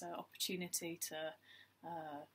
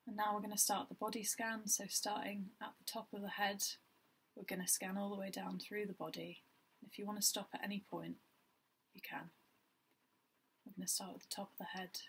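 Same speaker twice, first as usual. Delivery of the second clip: even, unhurried meditation guide with long pauses; guided meditation; 3 dB quieter than usual. Acted. Guiding listeners through a body scan, calm meditation voice. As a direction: lead gently in short phrases, leaving space between them.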